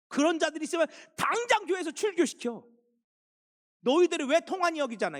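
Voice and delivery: the apostle Paul blasting the Corinthian church in fury; Paul's furious rebuke. Acted. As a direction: thunder at them like an outraged apostle. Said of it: "사도 바울이 버럭 화를 내는 거예요".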